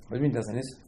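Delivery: normal talking voice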